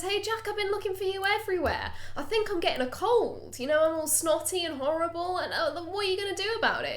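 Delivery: high-pitched put-on voice for a needy friend; Shannon's voice. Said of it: "and she goes".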